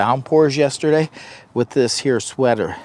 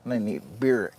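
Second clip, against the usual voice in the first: ordinary talk, nothing marked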